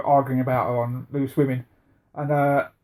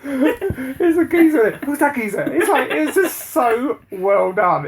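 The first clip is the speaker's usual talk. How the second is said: laughing; 9 semitones higher, 4 dB louder, slower than usual. Real, not acted. Laughing recalling the line.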